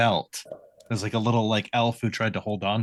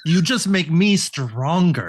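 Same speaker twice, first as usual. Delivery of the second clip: menacing character voice; 7 semitones higher, 5 dB louder, slower than usual. Acted. Taunting in character.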